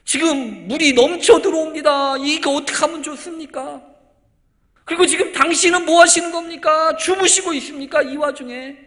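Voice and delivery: loud, high-pitched shouted protest; building from indignant grumble to yelling accusation; angry, resentful complaint. Acted. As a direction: cry out like disciples on a sinking boat. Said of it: "한층 화가 났어요. 불평도 하고 원망이".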